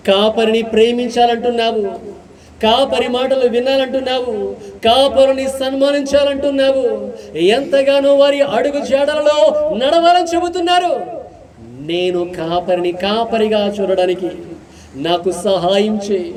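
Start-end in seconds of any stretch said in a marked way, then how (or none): 0.1-10.9 high, ringing prayer voice; impassioned pulpit prayer; fervent prayer. Acted. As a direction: cry out to God for the congregation.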